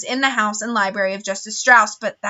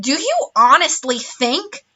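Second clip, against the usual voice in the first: slow, higher exasperated question; 5 semitones higher, slower than usual. A narrator's reading. Banker Mister Poe scolds Count Olaf, exasperated and slow. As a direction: drag it out in disbelief. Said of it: "in an exasperated voice".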